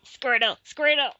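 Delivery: a light, half-hearted Pokemon cry; mock Squirtle call; Squirtle impression. Attempting a Squirtle cry on a podcast, half-hearted. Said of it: "I'm terrible at making Pokemon impressions".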